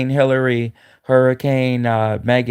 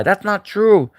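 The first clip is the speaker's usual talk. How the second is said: mock Candace Owens; 8 semitones higher, slower than usual. Imitating Candace Owens denying the spy claim, shrill protest. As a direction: squeal the denial, scandalised.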